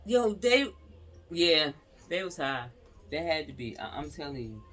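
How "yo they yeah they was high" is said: outraged exclamation. Real, not acted. Outraged at shooters.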